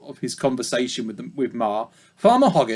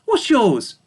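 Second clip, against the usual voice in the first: high, cheery pig voice; friendly piglet; 8 semitones higher, 3 dB louder than usual. Acted. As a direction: ask warmly like a small pig. Babe's voice.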